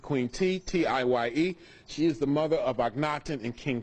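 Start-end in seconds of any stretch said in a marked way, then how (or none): 0.0-0.6 T said like the letter, "tee"; "T" with a long ee vowel; alternative pronunciation. Shown as a demonstration. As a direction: say "Queen T" to rhyme with "tea". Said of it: "some people pronounce it".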